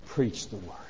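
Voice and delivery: quiet solemn delivery of the command; hushed command. Acted. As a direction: drop the voice to a grave near-hush. Three words long built up.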